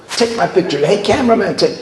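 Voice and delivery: put-on high, eager child voices in quick repeats; clamoring kids. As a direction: imitate kids pestering for attention. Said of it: "Kids come out of the woodwork".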